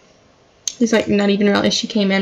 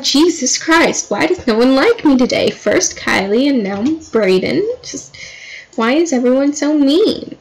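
higher-pitched, put-upon complaint; mock exasperation; 3 semitones higher than usual. Girlfriend feels everyone is against her, mock exasperated. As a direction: play up the wounded protest, stretching words out.

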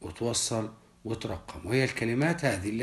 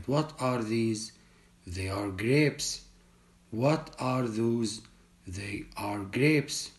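slow drill reading; much slower than usual. Shown as a demonstration. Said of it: "يعني استخدم these مع القريب".

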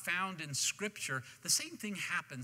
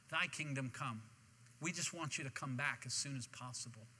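softer, lower plea to God; heartfelt prayer; 4 semitones lower, 7 dB quieter than usual. Acted. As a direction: drop into a quiet, earnest prayer. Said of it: "our heart's cry is".

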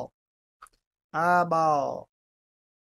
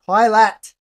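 loud quick callout; excited shout; 3 semitones higher, 9 dB louder than usual. Real, not acted. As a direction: shout it out as you spot it. Calls out anomaly spotted.

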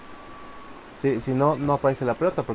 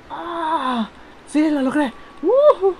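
high, loud cry of victory; triumphant shout; 5 dB louder, much slower than usual. Real, not acted. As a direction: cheer in triumph. Finally makes the jump.

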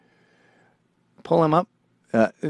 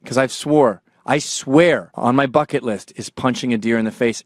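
normal talking voice

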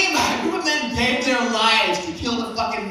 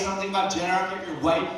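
ordinary delivery

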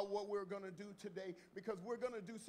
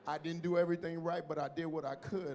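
preaching at full force; 10 dB louder than usual. Acted. Pastor proclaiming Jesus's words to his church, preaching. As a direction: stretch each phrase and press it home.

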